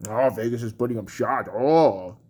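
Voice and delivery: low, quiet, dopey imitation; mock impressed fan. Rant host mocks fans praising Vegas, low unimpressed imitation. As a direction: drop low and mock the fan's awe. Mocks excuses made for the team.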